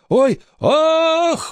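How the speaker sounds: very high drawn-out "oh, ah" wail; yelp, then wail long and high; howl. A narrator's reading. Boy looks at pirate while sister slips, high howl. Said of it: "взвыл, глядя на пирата".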